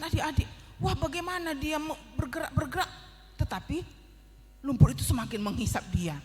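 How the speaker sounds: usual speaking voice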